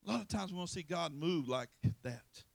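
normal talking voice

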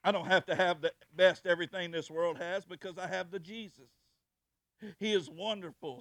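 loud pulpit declaration; emphatic preaching. Acted. Preacher testifying that he has Jesus, preaching loudly. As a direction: proclaim it forcefully to the room.

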